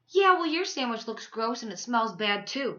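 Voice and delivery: loud, sharp comeback; snapped back. A narrator's reading. Salma to Lily after the insult, snapping back. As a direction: snap it back, hurt and mad. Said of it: "Salma snapped back".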